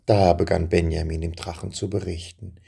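ordinary delivery

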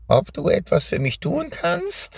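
high, crackling witch voice; croaking witch. Acted. Witch taunting the trapped Benjamin in a croaking voice. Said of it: "fragte sie mit krächzender Stimme".

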